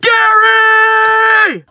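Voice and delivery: high, loud yell across the woods; frantic shout into the forest; screaming a name. Retold or imitated. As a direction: call out desperately for your lost buddy. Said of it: "I just start screaming".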